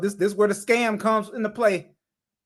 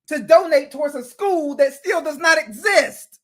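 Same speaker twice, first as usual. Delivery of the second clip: louder, higher, slow and indignant; heated accusation; 6 semitones higher, 5 dB louder than usual. Real, not acted. Commentator calling out the fake school, loud indignant accusation. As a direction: hammer home the outrage. Angry rant continues.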